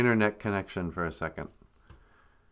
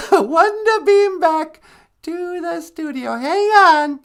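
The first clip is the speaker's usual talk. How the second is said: grandma voice; 7 dB louder, slower than usual. Acted. Grandma mocking the beam-back prayer.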